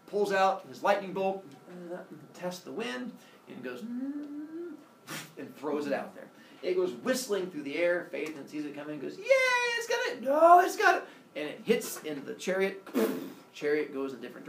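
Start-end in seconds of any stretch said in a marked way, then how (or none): 3.8-4.7 soft, high rising hum; imitated effort noise. Zeus aims his lightning bolt. 9.2-11.0 high, loud squealed alarm; hammed-up panicked cry; mock panic. Phaethon sees bolt coming.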